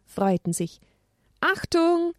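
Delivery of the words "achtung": high urgent warning; high-voiced caution. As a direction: call out a gentle alarm. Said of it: "mahnte Elia".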